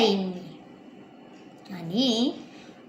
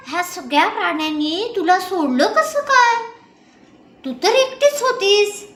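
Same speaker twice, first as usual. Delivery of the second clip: much higher, louder astonished voice; stunned at her escape; wide-eyed amazement; amazed exclamation; 6 semitones higher, 3 dB louder than usual. A narrator's reading. Dramatic retelling, amazed daughter.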